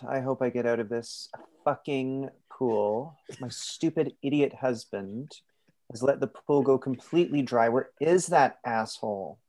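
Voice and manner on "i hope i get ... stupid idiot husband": ordinary delivery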